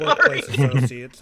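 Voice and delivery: slow loud correction. Acted. NPC corrects himself.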